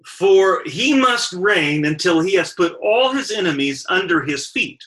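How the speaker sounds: loud, emphatic Bible reading; scripture reading. Acted. Preacher reads Paul's verse to the congregation, emphatic scripture reading. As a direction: read the verse out with weighty stresses.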